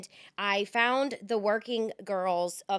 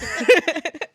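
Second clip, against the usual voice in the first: loud burst of laughter; 9 semitones higher, 14 dB louder than usual. Real, not acted. Laughing at own bad accent.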